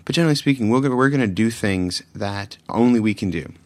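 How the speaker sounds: ordinary delivery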